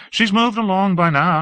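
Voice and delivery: a lofty, admiring high voice; Hawke, airy and effusive. Acted. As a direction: gush about the city grandly.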